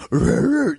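high cartoon-dog growl; cartoon dog noise. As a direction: imitate a cartoon dog's "rrr". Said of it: "a cartoon dog was".

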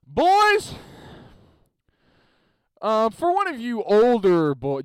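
loud, high holler to the line; big acted coach voice; coach addressing team. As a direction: call the boys to attention.

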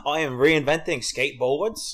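slow mock Australian announcement; Australian accent. Co-host playing the skateboard inventor, slow mock Australian accent. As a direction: declare it slowly in a broad Australian accent. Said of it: "in an Australian accent".

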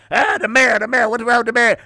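high mock whine of a complainer; mocking whiner. Mocking the people who gripe at the mayor, high whiny voice. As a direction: whine it high like a griping citizen.

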